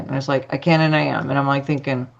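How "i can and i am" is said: rote, half-hearted recital; going through the motions, understated; not meaning it. Retold or imitated. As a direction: repeat it dutifully, without conviction. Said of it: "say it like you mean it".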